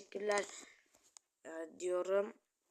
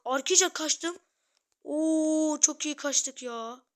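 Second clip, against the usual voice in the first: higher, louder, slow gleeful yell; thrilled shout; 6 semitones higher, 8 dB louder, slower than usual. Real, not acted. Telling viewers he got away, thrilled high yell. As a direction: crow about the escape, loud and high.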